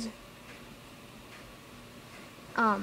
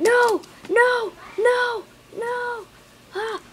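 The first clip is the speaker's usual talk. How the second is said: much higher, loud wailing no; despairing shouts; 12 semitones higher, 9 dB louder, much slower than usual. Real, not acted. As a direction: scream as they die. Mobs dying in game.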